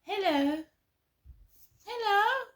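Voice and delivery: high, breezy mock-innocent hello, rising up; mock greeting. Predator mocked as breezily saying hello. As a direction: mimic a predator waving hello without a care. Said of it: "the predator's still wandering around".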